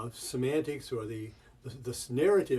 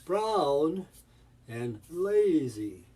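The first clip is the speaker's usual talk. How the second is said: BROWN and LAZY drawn out, up and back down; rise-fall intonation; 3 semitones higher, 4 dB louder, much slower than usual. Shown as a demonstration. Teacher isolating the colors, long rise-fall on "brown" and "lazy". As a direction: swoop loudly up and down on "brown", then "lazy". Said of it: "go up on it and come back down".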